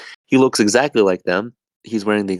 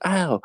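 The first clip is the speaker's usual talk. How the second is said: yelp of pain; 10 semitones higher, 4 dB quieter than usual. Retold or imitated. Jake hit again.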